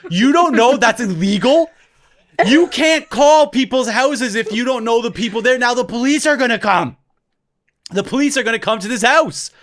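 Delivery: high, loud hammed-up scolding; mock alarm. Older brother milking the mistake to traumatize his little brother, mock alarm. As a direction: lean into it to scare a little kid. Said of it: "we start leaning into this".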